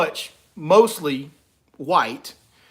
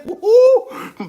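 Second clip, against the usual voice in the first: 1 whooping cheer; 8 dB louder than usual. Real, not acted. Celebrating election joke.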